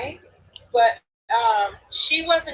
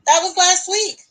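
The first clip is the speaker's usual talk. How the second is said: louder disbelief; 7 semitones higher, 8 dB louder than usual. Retold or imitated. Repeating to the friend that the wedding was last week, stunned. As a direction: stress on LAST, higher and insistent.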